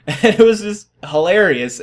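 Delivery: high, loud laughing voice. Real, not acted. Laughing at own story.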